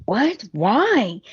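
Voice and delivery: baffled, voice climbing; confused surprise. Retold or imitated. Thomas hearing Sheila did it, baffled. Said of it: "he's like".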